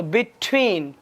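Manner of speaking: between, leaning hard on the T; stress on the T. Shown as a demonstration. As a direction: punch the T that opens the second half of between. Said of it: "टी के ऊपर जोर दिया जाता है". (between में टी पर ज़ोर)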